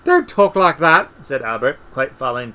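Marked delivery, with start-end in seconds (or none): 0.0-1.1 high, loud protest; rattled, pitched-up outcry; alarmed plea. A narrator's reading. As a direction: beg him loudly to stop. Albert frightened by Mort's Death-like talk.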